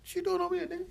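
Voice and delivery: high, delighted call-out; surprised to spot a friend; mock surprised shout; surprised greeting. [name] greeting him, imitated.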